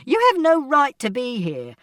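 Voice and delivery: high, loud, outraged mother; angry shout. A narrator's reading. Mother confronting father in the flat, shouting. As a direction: shout at him that he must leave. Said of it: "And Mother shouted".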